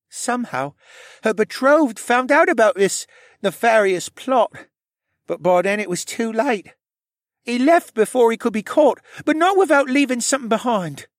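put-on high young character voice; high-pitched, earnest tale-telling; Rylan, shy confessing stable boy. A narrator's reading.

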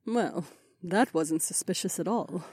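soft, high, dry aside; smirking sarcasm. A narrator's reading. Aliona to her husband after the slammed door, smirking. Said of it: "said with a smirk".

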